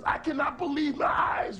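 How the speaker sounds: high, slow cry of disbelief; shocked cry. A narrator's reading. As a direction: gasp it out in disbelief. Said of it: "Brother Umari said".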